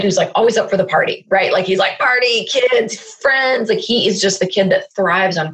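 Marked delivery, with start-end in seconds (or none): 2.0-3.7 put-on enthusiastic kid. Voices fun-loving son's enthusiasm.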